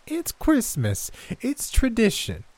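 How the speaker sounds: put-on high, slow, firm old woman; high insistent granny. A narrator's reading. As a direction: insist sweetly but firmly. Gran voiced, insisting.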